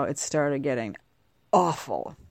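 ordinary talk, nothing marked

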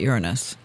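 "Uranus" said as YOOR-uh-nus; first syllable stressed in "Uranus"; astrologers' pronunciation. Shown as a demonstration. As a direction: say "Uranus" like "urine-us". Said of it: "I've heard people say".

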